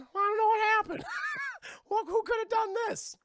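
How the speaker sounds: very high, loud mock-innocent voice; hammed-up high voice playing dumb; feigned innocent chair. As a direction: squeal it as if clueless. Voicing the innocent-acting chair.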